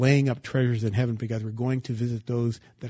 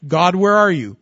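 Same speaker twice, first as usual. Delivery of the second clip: loud, higher cry to God; anguished plea; 5 semitones higher, 9 dB louder than usual. Acted. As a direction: cry out where are you. Believer's plea voiced.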